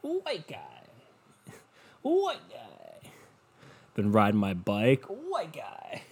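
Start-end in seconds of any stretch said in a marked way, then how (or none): 0.0-3.1 high, soft, put-on catchphrase voice; mock catchphrase. Repeating the comedian's bit. 5.1-6.0 mock catchphrase. Repeating the bit again.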